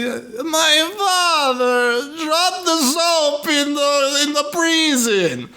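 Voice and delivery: high, very drawn-out wailing caricature; bad SNL character. Mocking a movie performance as cringe, hammed-up SNL character voice. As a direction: stretch every word in a hammy, high-pitched voice. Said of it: "he's playing an S N L character".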